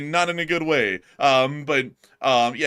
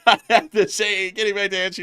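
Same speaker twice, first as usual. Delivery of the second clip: laughing; 8 semitones higher, 7 dB louder than usual. Real, not acted. Laughing through hiccup embarrassment.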